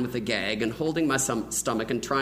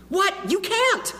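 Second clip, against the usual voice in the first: put-on high panicked kid cry; panicked child; 4 dB louder, slower than usual. Boy stopping his friend telling mom, high panicked voice. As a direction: blurt it like a boy caught out. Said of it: "I exclaimed".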